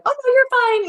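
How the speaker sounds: high, light, cheerful brush-off; breezy reassurance. Retold or imitated. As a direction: wave it away like a mom who isn't worried. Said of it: "if the parent's like".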